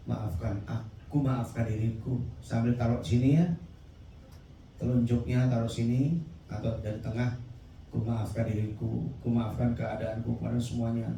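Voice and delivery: soft, low guiding voice; preacher guiding the crowd to forgive, softly; gentle guided release. Real, not acted. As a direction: lead them softly through letting go. Guides forgiveness breathing exercise.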